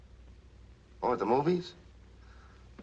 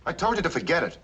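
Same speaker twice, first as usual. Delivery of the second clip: sharp, higher angry outburst; 10 semitones higher than usual. Acted. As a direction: snap at her, fed up. Husband snaps during argument.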